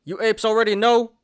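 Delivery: loud, high hype-bro chant; crypto bro. Acted. Meme hype sign-off to viewers.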